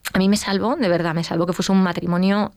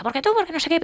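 much higher, retold argument shout; mock quarrel; 10 semitones higher, faster than usual. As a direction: replay the fight's accusation. Retoma la bronca en el coche. (bronca imitada, voz muy aguda)

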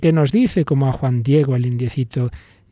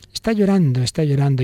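normal talking voice

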